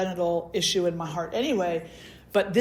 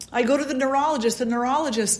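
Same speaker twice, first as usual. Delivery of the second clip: usual speaking voice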